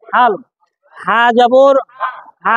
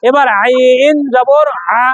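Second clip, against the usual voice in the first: slow drill; much slower than usual. Shown as a demonstration. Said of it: "slow drilled spelling for students".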